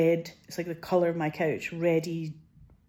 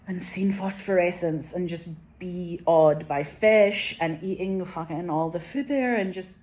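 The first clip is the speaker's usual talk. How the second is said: ordinary delivery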